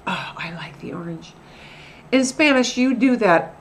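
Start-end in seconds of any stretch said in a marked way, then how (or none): 0.1-1.3 breathy whisper, "Oh" rising; whispered oh I like the orange; whispered emphasis. Shown as a demonstration. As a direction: whisper it like a savoured secret. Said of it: "the tone of our voice".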